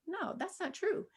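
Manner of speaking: normal talking voice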